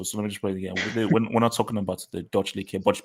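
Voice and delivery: ordinary delivery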